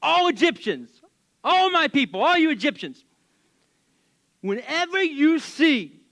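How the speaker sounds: a pharaoh thundering an order to his people; proclaiming Pharaoh. Acted. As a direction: boom it out like a king laying down the law. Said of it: "And he said".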